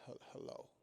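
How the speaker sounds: very soft, faltering sleepy hello; barely awake pickup; groggy phone answer. Acted. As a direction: answer as if just woken and unhappy about it. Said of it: "answering the phone like something's wrong".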